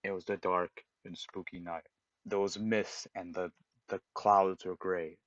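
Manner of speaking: low, hushed Halloween tale; spooky storyteller. Retold or imitated. YouTuber opening a Halloween-themed game story, spooky. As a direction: imitate a storyteller setting an eerie mood, softly. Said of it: "he's being in with a tone".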